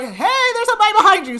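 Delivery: high, loud panicked warning; alarmed shout. Retold or imitated. Reggie yells a warning to the girl, loud and high. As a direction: yell it to warn her.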